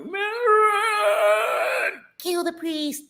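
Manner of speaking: high, drawn-out demonic cry. Retold or imitated. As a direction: imitate a possessed movie demon calling out. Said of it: "she said it in the demon voice".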